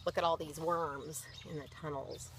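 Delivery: plain reading voice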